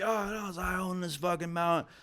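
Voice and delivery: high-pitched, whiny mock protest; mock crackhead indignation; imitating defiant crackhead. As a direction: pitch it way up as a deluded man insisting. Crackhead insists he owns mountain.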